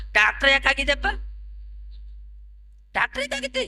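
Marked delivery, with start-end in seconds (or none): 2.9-3.6 soft, very high falsetto; high-pitched mimicry of the retort; mocking echo. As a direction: parrot the question in a squeaky-high little voice. Retort repeated in put-on voice.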